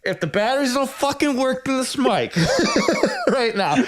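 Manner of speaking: high, giggling mock menace; laughing threat. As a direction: swear at the mic while cracking up. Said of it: "in my head, I'm like".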